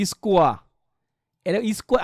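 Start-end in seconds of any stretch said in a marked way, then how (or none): 0.1-0.5 স্কোয়া ending on an open "aa", no r; silent final r. Shown as a demonstration. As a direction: say স্কোয়া as "iskwaa". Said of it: "আমরা বলি কি স্কোয়ার বলি না?".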